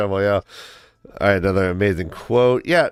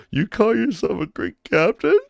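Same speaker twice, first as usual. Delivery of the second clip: high sneering put-on voice; mocking heckler; 9 semitones higher than usual. A soldier mocking the great captain, high and sneering. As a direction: scoff at him, pitched way up. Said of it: "this one guy is like".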